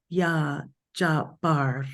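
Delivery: "Ya Jabar" said slowly, with a rolled R and a popped B; sacred word demo. Shown as a demonstration. Teacher showing the group the sacred word, slow and careful. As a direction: slowly sound out "Ya Jabar", rolling the R. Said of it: "there's a little roll of the R".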